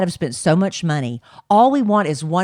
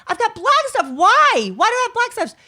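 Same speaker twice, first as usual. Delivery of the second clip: put-on much higher, insistent kid; whiny kid voice. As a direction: press the question like a fretful boy. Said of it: "He said".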